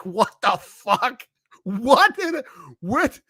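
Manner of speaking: high, incredulous what the fuck; shocked disbelief. Retold or imitated. Retelling his shock at the Denny's wait. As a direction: squeak out stunned disbelief.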